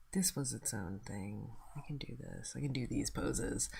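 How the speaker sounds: low mumbled self-talk; muttering to myself. Real, not acted. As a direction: murmur it under the breath. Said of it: "I'm just muttering to myself".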